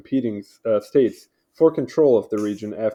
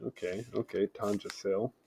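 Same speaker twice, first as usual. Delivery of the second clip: talking through a mouthful of cheese; chewing while reading aloud; mouth full; 3 semitones lower, 14 dB quieter than usual. Real, not acted. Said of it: "Sorry, I'm eating a block of cheese".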